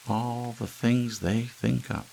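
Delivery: high, slow, wistful wife; Hazel's voice. A narrator's reading. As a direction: linger on it like a simple housewife.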